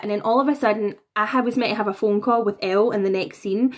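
ordinary talk

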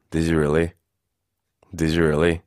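low, unimpressed "Did you really"; sarcastic disbelief. Shown as a demonstration. Teacher voicing a friend doubting a boast, low sarcastic disbelief. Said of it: "내려서 얘기하면은 조금 비꼬는 느낌".

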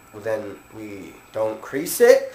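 very slow, quiet, questioning rise at the end; low, drawn-out, careful reply; hesitant obvious answer. Retold or imitated. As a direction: spell it out softly, as if unsure she gets it. Deadpan reply to customer's silly question.

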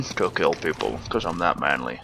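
terrible movie tough-guy impression, dropped low; Liam Neeson. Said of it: "I think that was Liam Neeson".

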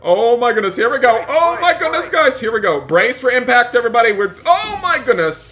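shouting as the plane hits the water; panicked yell. Acted. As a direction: brace for the crash in a panic.

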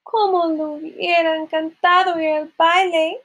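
high, mildly wailing wish; tearful lament. A narrator's reading. Cinderella left at home, high mild lament. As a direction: lament it with no real sobs. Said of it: "se echó a llorar".

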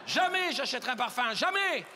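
high, emphatic refusals, the key word stretched; indignant vow. Acted. Comedian tells the audience what they'll never buy, indignant. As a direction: swear it off in mounting comic outrage.

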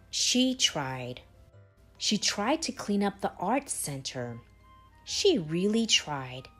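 ordinary delivery

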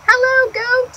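high greeting as Duck; cheerful duck greeting. Acted. Duck greeting Goat, high and cheerful. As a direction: pipe up a friendly hello.

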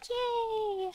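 high, squeaky yay; cheering. Real, not acted. Cheering skulls.

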